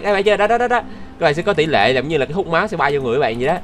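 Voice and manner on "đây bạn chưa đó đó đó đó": high, eager "đó, đó"; excited pointing. Real, not acted. Gamer showing viewers the lifesteal, eager and excited. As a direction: keep pointing it out keenly.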